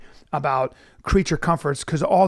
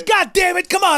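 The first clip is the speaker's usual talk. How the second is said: higher exasperated groan; like a sick joke of a father; fed-up dad; 12 semitones higher, 3 dB louder than usual. Retold or imitated. The dad scolding his son, higher and fed up. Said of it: "He goes".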